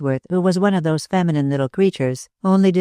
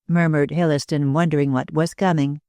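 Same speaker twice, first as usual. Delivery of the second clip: plain reading voice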